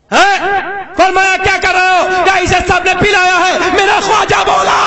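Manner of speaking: high, ringing sermon shout; impassioned high-pitched oratory; full-force preaching. Real, not acted. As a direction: thunder it out to a crowd.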